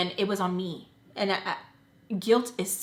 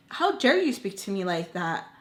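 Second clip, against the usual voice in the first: normal talking voice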